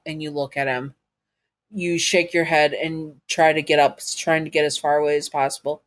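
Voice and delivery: plain reading voice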